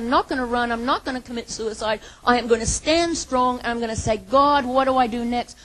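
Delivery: loud, high, determined declaration; David's resolve. Acted. David vowing to stand strong, loud. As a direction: plant your feet and declare it.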